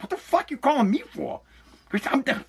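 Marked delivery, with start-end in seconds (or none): 0.0-1.4 irritated, mumbled under the breath; annoyed snap. Retold or imitated. Dad answering the school's call, annoyed mumble. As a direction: brush off the caller with irritation.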